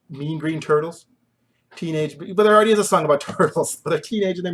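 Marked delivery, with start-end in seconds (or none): none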